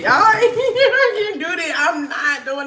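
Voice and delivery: high, slow, breaking into laughter; laughing too hard to go on. Real, not acted. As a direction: lose it mid-sentence. Overcome with laughter.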